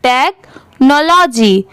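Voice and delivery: Technology slowly, with a break after "tek"; split-word reading. Shown as a demonstration. Teacher showing how parts join, technology slow and split. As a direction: say technology as tek, pause, nology. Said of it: "अब इसे साथ में कैसे पढ़ेंगे".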